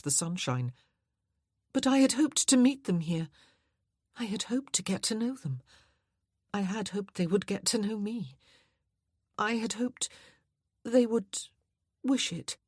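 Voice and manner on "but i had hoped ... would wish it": put-on higher, halting young woman, disappointed; Anna's dismayed voice. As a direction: let each I had hoped falter higher.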